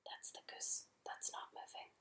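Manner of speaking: faint unvoiced aside; whispering. Real, not acted. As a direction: breathe it as a secret.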